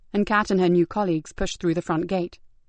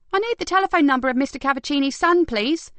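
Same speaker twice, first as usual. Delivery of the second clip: urgent; 7 semitones higher, 3 dB louder than usual. Acted. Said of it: "Kat asked urgently".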